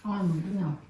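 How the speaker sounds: ordinary delivery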